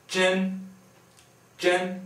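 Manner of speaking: zhen hoch und gleichbleibend; first tone. Shown as a demonstration. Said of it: "First tone".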